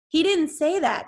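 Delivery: ordinary delivery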